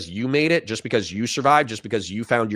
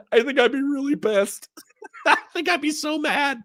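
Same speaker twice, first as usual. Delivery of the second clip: much higher, squeezed by laughter; laughing; 13 semitones higher than usual. Real, not acted. Host joking they'd be pissed about taxes, laughing helplessly. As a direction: get the words out through giggles. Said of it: "I'm sorry for laughing".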